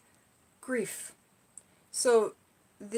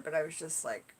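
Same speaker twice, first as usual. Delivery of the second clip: ordinary delivery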